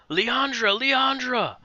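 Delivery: high, desperate cry of a name; desperate yell. Acted. Soldier screams for his captured sister, high desperate yell. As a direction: call out for his sister in panic. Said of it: "he all of a sudden yells".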